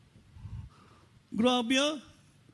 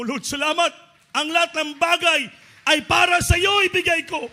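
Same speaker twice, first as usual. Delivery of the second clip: higher, louder cry of thanks; loud grateful prayer; 10 semitones higher, 9 dB louder than usual. Retold or imitated. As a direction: call out to the Lord, overflowing with gratitude. Crying out thanks to God. (malakas na sigaw ng pasasalamat sa Panginoon)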